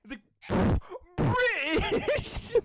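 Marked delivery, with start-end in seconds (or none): 1.2-2.5 very high, drawn-out squeal; high-pitched meme impression. Retelling the British guy meme, squealed imitation. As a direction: stretch it into a squeaky mock-astonished cry. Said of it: "so high pitched at the end".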